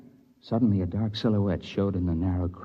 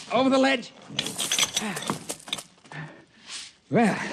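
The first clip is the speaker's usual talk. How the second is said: narrator's younger self in the crevasse. Acted. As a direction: shout up the ice wall, cheerful and relieved.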